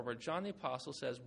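ordinary delivery

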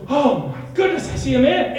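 high, loud frightened cry; acted fright. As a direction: play a panicked sailor spotting a stranger. Frightened disciples see a figure.

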